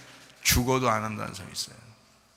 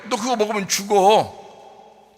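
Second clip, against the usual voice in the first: slow, grave voice of God; God warning; 8 semitones higher, 8 dB louder, much slower than usual. Acted. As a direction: draw out the warning slowly. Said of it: "하나님 입장에서 뭐예요?". (하나님의 느리고 엄숙한 경고)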